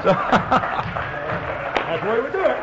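slow words through a laugh; amused laugh at the story's end. Retold or imitated. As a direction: chuckle out the punchline. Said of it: "that's how it's done".

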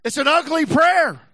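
it's an ugly prayer proclaimed loud; loud declaration. Real, not acted. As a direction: preach it out full voice. Loud then hushed repeat.

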